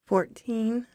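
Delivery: put-on high, soft little girl voice; timid young girl. Bought girl telling the rider her age, timid. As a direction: answer small and high, unsure.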